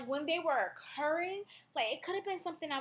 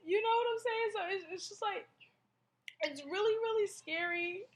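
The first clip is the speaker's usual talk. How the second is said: higher, tearful voice close to crying; fighting back real tears while talking; choked up; 6 semitones higher than usual. Real, not acted. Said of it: "I'm getting choked up now".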